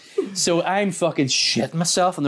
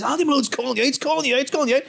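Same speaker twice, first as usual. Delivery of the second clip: excited; 10 semitones higher, much faster than usual. Retold or imitated. Retold excited video messages.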